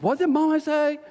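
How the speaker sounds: high-pitched mocking echo of "Mommy". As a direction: repeat it back way up high, teasing. Mock echo of sister's British "Mommy.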